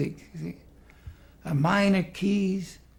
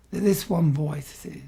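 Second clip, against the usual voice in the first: normal talking voice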